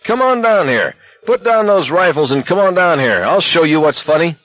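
high-pitched, straining call to fight; enraged challenge. A narrator's reading. Enraged McVrees challenges the soldiers.

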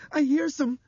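put-on high, loud, urgent girl's voice, cut short; sharp cry. A narrator's reading. Dinah warns the others of danger, sharp cry. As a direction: blurt the alarm urgently. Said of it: "Dinah cried sharply".

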